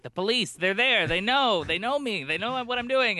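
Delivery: mock alarm. Surprised teen spotting police at a party, mock alarm. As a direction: imitate your own paranoid surprise. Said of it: "always so surprised".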